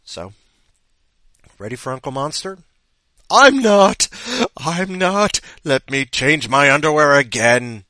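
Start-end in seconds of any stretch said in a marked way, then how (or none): 3.3-7.8 loud, high protest; mock panic. Host not ready for Uncle Monster, mock panicked yelp. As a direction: yelp it as comic alarm.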